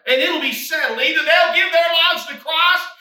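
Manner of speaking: loud, high, driving exhortation; rally the congregation; preaching at full force. Acted. Preacher urging the church to give it to Jesus, fervent.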